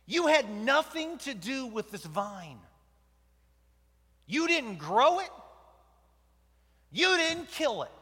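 loud, high and sharp scolding; God scolding Jonah, rising loud rebuke; stern rebuke. Acted.

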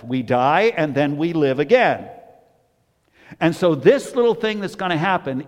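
climbing pulpit proclamation; preaching. Acted. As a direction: preach it out with force. Preaching peak.

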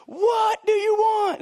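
high, loud, drawn-out shout; exasperated yell. Acted. Parent answering a friend while kids fight, yelled. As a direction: holler it slowly in mock exasperation.

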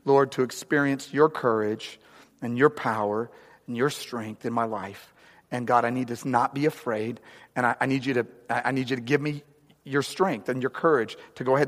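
soft, low, intimate prayer; quiet prayer. Acted. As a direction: pray quietly and humbly. Model prayer for the congregation.

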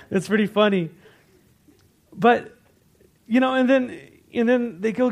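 ordinary talk

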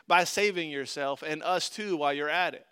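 a touch of a sneer, read aloud; lightly scoffing challenge; mild scoff. A narrator's reading. Criminal beside Jesus taunts him, mildly scoffing.

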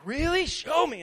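put-on high, loud excited kid asking for a show; eager child's demand. Daughter daring dad to show the armpit noises, eager. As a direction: pipe up loud and high, wanting proof.

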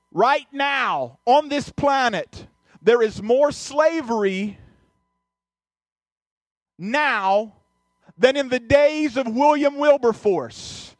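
slow, weighty, each phrase landing; slow emphatic quote. Retold or imitated. Quoting a speaker's warning to the church, slow and weighty. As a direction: drive home the statistic slowly. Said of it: "She says".

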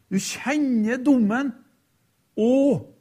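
ordinary delivery

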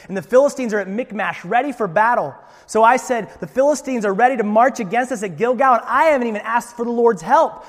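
loud, high, agitated pleading; defensive excuse. Acted. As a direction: protest your innocence, voice rising. Saul voiced defending himself.

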